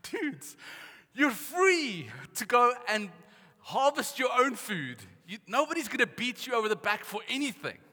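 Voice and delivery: high, loud, incredulous outburst; exasperated exhortation. Acted. Exasperated scolding of the Israelites.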